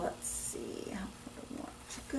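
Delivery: unvoiced whisper to herself; whispered self-talk; whispered aside. Real, not acted. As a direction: mutter it privately while choosing. Teacher musing to herself.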